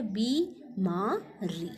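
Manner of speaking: बीमारी very slowly as "bee, maa, ree"; syllable by syllable. Shown as a demonstration. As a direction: break बीमारी into drawn-out syllables. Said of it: "हम इसे कैसे लिखेंगे".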